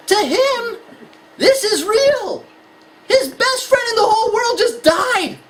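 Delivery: high, drawn-out insistence; impassioned emphasis. Real, not acted. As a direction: drive home how real it is. Passionately voicing the son's view.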